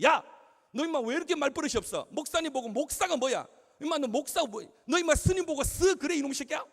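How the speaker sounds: loud, high, re-enacted telling-off; angry scolding. Pastor to a disrespectful girl, furious scolding. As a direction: explode at a rude child. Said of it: "막 야단을 쳤습니다".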